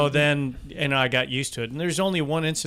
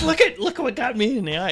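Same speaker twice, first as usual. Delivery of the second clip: excited showing off; 12 semitones higher, 5 dB louder than usual. Retold or imitated. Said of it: "And I'm like".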